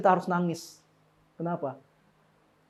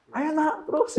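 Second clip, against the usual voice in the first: put-on high sing-song egging the child on; cheerfully egging on a kid with a marker; mock cheering parent; 11 semitones higher, slower than usual. Parent egging toddler on, mock cheerful and high.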